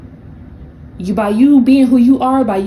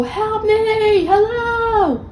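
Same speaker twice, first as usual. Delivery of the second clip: high, thin cry for help from far down a hole; hammed-up cry from the bottom of a pit; mock scream for help; 12 semitones higher, much slower than usual. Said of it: "They're screaming from the bottom of the hole".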